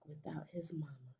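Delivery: whispered mama. A narrator's reading. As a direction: whisper the word. In the dark, fading.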